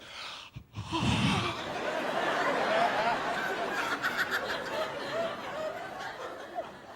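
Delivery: excited gasp. Retold or imitated. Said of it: "She's like".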